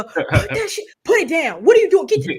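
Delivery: high, loud scolding mom; mom hollering. Retold or imitated. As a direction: holler at the kids in a high, exasperated voice. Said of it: "she, like, hollering at you".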